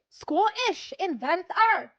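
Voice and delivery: loud, slow hammed-up Scottish; bad Scottish accent. Describing James Watt in a bad Scottish accent. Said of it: "bad Scottish accent".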